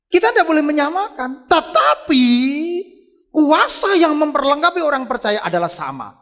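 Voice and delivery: loud, high-pitched preacher's cry; high, ringing sermon climax; full-force preaching. Real, not acted. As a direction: proclaim it forcefully to the congregation.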